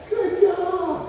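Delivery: bright high-pitched praise; high excited praise. Real, not acted. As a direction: lift the voice to reward him. Praising the dog's eye contact.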